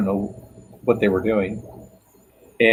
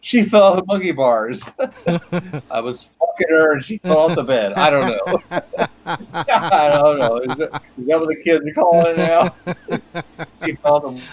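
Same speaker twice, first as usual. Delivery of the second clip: cracking up, pitched up; laughing while talking; 4 semitones higher than usual. Real, not acted. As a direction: barely get it out through real laughter. Cracking up at a euphemism.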